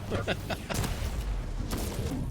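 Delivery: high airy burst of laughter; laughing. Real, not acted. Caster laughing at chase.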